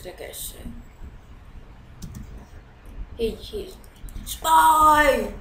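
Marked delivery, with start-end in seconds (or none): 4.2-5.3 loud, high mimicked shout; imitated spy call-out. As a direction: mimic another player yelling it. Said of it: "He said".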